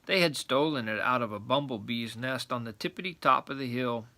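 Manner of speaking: ordinary delivery, nothing marked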